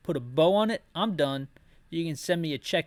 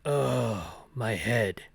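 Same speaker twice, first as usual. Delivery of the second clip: lower, gruff-leaning Barry take on the line; the Barry voice, low and groggy; Leon's line slipped into Barry's low voice; 5 semitones lower, much slower than usual. Acted. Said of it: "Now that's Barry's voice".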